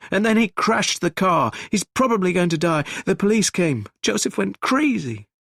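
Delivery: frantic high distraught voice; hysterical panic. Acted. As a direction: babble the news in panic. Gregory panicking over the killings.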